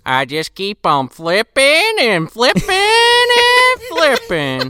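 put-on high, slow, drawn-out old man voice; Gramps. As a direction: stretch each word as a cartoon grandpa. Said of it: "Gramps was fucking cooking it up".

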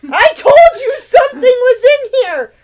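high, loud triumphant shout. Acted. Hope shouting to her family from the table, high loud shout. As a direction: yell it from up on the table. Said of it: "Hope shouted".

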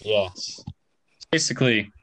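normal talking voice

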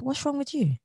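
high, startled what's wrong; taken-aback reply. Retold or imitated. Listener recoiling from an aggressive greeting, startled. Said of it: "turn around and say aggressive".

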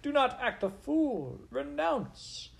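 very high-pitched, frantic motherly plea; mother shouting. A narrator's reading. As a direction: implore your son in a piercing falsetto. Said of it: "shouted his mother".

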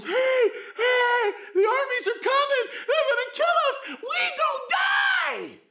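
panicked high yelling servant; terrified assistant shouting to the prophet, very high and loud; freaked out. Retold or imitated. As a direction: shriek it like the army is at the door. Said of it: "And he's freaked out".

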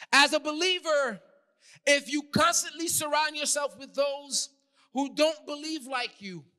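high, loud, driving sermon; urgent preacher pushing through each clause; full-force preaching. Real, not acted. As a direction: build a list of warnings to a peak.

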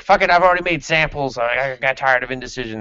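high, loud exasperated blurt; mock exasperated outburst; blurted retort. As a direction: snap it out fast, fed up. Retelling hero's blunt answer.

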